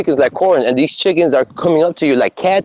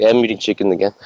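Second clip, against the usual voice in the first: glum realization; 6 semitones lower, faster than usual. Retold or imitated. Cooking chicken breast at home, lower deflated muttering. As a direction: say it like it ruins your dinner. Dejected at home meal prepping.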